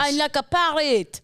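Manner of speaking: mock French accent. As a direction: copy the line in a put-on French accent. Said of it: "That was French".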